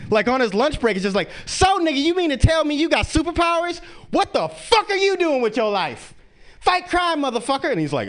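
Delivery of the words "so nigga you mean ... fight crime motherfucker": put-on girl tells the hero to fight crime; sassy girl. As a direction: scold him like a bold little girl. Girl confronts Icon.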